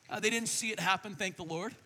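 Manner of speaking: usual speaking voice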